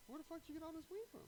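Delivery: very high fast outraged question; mock squeaky interrogation. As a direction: demand it like a shocked uncle. Uncle interrogating.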